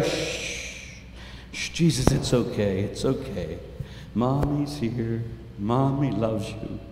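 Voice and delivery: soft, slow hushing lullaby voice; soothing mother. Acted. Mother soothing her crying newborn, soft and slow. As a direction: hush a crying baby gently. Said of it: "and go".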